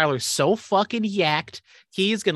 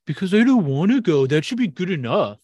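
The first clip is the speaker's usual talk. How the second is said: mocking friend's excuse; 3 semitones higher than usual. Trainer mimicking friend who skips gym, petulant mockery. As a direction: imitate a friend making a lame excuse. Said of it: "You're like".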